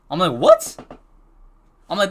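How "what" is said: sharply higher, disbelieving question; incredulous what. Retold or imitated. Student contradicted by teacher about his thumb, incredulous. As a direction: act stunned, pitch leaping up. Acts shock at being contradicted.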